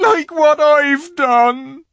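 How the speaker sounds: loud, high, slow wail; anguished faun. A narrator's reading. Faun answering Lucy's question, wailing in shame. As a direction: drag out a wailed confession.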